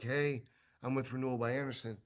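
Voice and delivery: low, soft, creepy come-on, with a strange tone; creepy stare. Retold or imitated. Salesman mocks a creepy greeting at a customer's door, low and soft. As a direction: murmur the greeting like you're gazing too long into their eyes. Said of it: "That's a different level of creepy".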